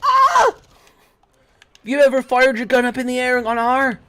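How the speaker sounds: high, loud, fast outburst; excited loud yell. Real, not acted. Shouting while playing.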